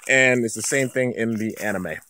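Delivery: ordinary delivery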